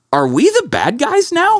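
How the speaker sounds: high, slow, incredulous; stunned realization. Retold or imitated. Realizing at dinner he might be the bad guys, stunned high voice. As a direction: ask it with dawning horror, high and drawn out. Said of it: "I was like".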